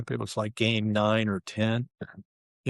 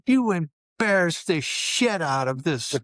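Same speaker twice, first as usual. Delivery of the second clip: higher, louder, slow furious chewing-out; furious coach; 6 semitones higher, 3 dB louder, slower than usual. Retold or imitated. Coach yelling at players after blowout, furious. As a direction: coach tearing into his losing team. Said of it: "He was so mad".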